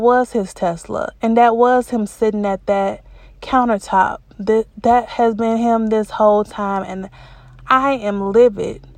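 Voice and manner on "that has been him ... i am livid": usual speaking voice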